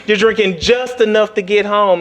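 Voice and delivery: ordinary delivery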